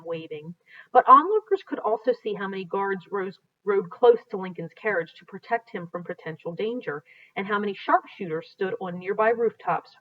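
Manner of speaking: reading aloud from a history book, steady and clear; storybook narration. Acted. A teacher reading a Lincoln history aloud to students, in narration voice.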